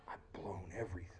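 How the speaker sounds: whispered inner thought; despairing whisper. Pretend. Peter alone after the denial, whispering despair. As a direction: breathe it out as a hopeless whisper.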